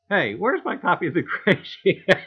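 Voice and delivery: a light mock complaint that breaks into a laugh mid-line; laughing mock Shay. Teasing a friend about missing copies, laughing through it. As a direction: play the lost-copy gag, cracking up. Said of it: "Imagine Shay going".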